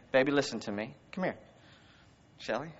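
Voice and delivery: soft, low, gentle coaxing; tender father. Retold or imitated. Father comforting his crying daughter, softly and tenderly. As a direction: call your child over gently. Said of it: "I'd say".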